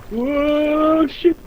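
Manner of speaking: sudden panicked yell; screaming while falling. Real, not acted. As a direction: shout it as you slip and fall. Falls off while climbing.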